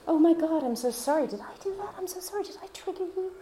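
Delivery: high, anxious over-apologizing; frantic people-pleaser. Retold or imitated. Mocking her old pleaser self to the listener, high and frantic. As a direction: gush apologies like a panicked pleaser.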